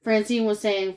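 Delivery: plain reading voice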